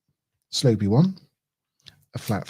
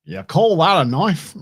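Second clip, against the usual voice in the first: attempted Australian accent; 5 semitones higher than usual. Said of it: "I can't do a, an Australian accent".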